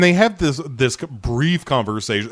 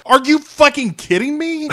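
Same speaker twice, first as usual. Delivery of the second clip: very high, incredulous squawk; hammed-up disbelief at an insult; mock outrage; 13 semitones higher than usual. Griffin reacts to being called the baby, outraged.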